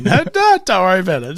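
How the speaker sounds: high, dismissive mum voice; mock breezy mum. Mum on the phone brushing off her son's worry.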